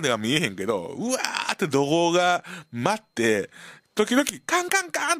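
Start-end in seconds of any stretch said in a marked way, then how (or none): 4.5-5.2 high clanging "キンカンカン" like a cleaver on metal. Retold or imitated. Narrator imitating cleaver noise from the kitchen, high metallic clang. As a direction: ring it out high like a cleaver striking. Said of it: "って包丁の音するから".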